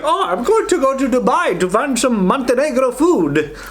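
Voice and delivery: high, light mock tourist voice; hammed-up foreign visitor. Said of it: "Was like".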